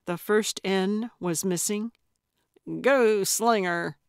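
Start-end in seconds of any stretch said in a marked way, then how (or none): 2.8-4.0 slow, barely contained amusement; mildly teasing, drawn out; suppressed cackle. A narrator's reading. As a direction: hold back a cackle, slowly. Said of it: "barely keeping the cackle out".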